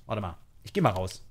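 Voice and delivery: ordinary delivery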